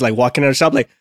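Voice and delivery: ordinary talk, nothing marked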